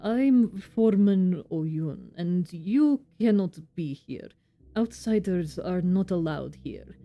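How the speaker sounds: put-on slow, amateur Russian-accented game character; bad Russian accent. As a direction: play the foreman with a clumsy Russian accent. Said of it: "my very bad Russian accent".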